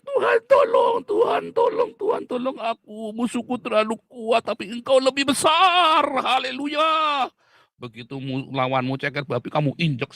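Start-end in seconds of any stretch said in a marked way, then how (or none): 0.0-7.3 high, shouted, pleading mock prayer; wailing parody of a frightened churchgoer; mock whiny prayer. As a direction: ham up a cowardly believer crying to God. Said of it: "mewek-mewek".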